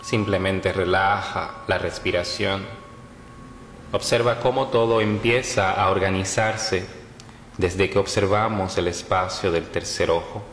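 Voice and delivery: measured meditation voice with long pauses; guided meditation. Acted. Yoga teacher leading listeners through Yoga Nidra, guided meditation. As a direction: guide them phrase by phrase, leaving long silences between.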